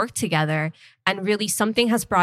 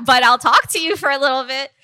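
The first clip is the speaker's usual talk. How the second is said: laughing; 7 semitones higher, 7 dB louder than usual. Real, not acted. Laughs about homework.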